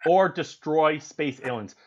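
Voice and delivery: normal talking voice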